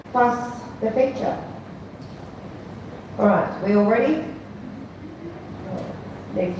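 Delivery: ordinary delivery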